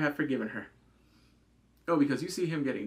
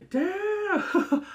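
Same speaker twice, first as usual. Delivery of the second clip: much higher, louder damn; stunned outburst; shocked exclamation; 6 dB louder than usual. Real, not acted. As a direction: blurt it out in disbelief. Shocked reaction to the story.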